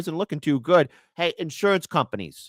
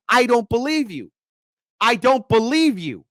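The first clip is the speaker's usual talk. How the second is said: angry repeat; 7 semitones higher, 3 dB louder than usual. Retold or imitated. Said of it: "You have to get angry".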